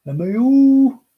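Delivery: high, loud singsong call; loud, high drawn-out call for the mail. Pretend. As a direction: belt it out across the house. Louder repeated mail call.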